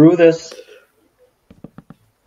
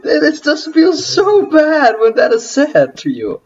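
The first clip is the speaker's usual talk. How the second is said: mock hurt whine; 4 dB louder than usual. Hurt whining remark.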